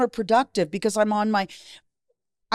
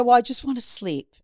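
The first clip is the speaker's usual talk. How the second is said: lightly casual, pitched up; 6 semitones higher than usual. Retold or imitated. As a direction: retell it as no big deal. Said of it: "I said it so casually".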